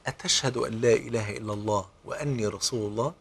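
a tender, smiling question; smiling gentle question. A narrator's reading. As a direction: ask with a warm smile. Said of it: "فابتسم في وجهه".

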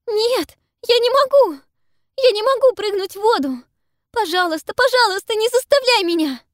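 high, loud, desperate begging; terrified pleading. A narrator's reading. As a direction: beg in terror not to go. Pippa refuses, terrified.

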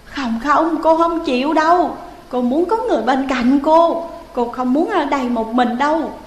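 high, loud whiny refusal; stubborn refusal. Acted. Ghost Hiền stubbornly refusing to let Thẩm go, high and whiny. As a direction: dig in like a sulking child.

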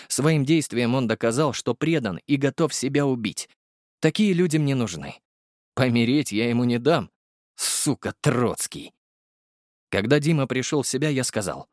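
normal talking voice

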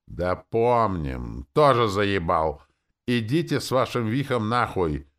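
loud, drawn-out heckling; crowd yelling. A narrator's reading. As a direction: yell back like a fed-up audience. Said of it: "орала публика". (публика раздражённо орёт)

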